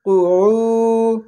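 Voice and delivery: কুউ with the উ drawn very long; long u held. Shown as a demonstration. Teacher showing a long vowel, held. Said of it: "যুক্ত করে".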